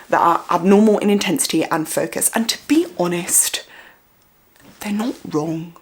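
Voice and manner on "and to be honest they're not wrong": whispered aside. Real, not acted. Confiding aside to viewers.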